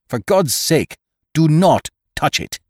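high loud pleading warning; urgent cry. A narrator's reading. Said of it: "cried the doctor".

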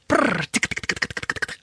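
trilled brrr into rapid ticking; imitated typing noise. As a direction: rattle off unvoiced tongue clicks like fast typing.

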